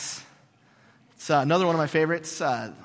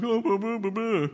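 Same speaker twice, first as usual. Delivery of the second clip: sarcastic boo-hoo; 6 semitones higher than usual. Retold or imitated. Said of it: "a little sarcastic there?".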